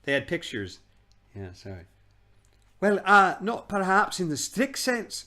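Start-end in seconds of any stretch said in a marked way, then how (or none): 2.8-5.3 put-on halting, nervous old man; stammering. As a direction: stumble over the words. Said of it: "stammered Uncle Andrew".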